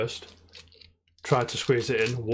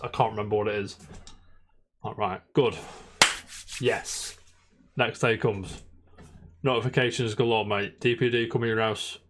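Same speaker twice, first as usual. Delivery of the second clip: ordinary talk, nothing marked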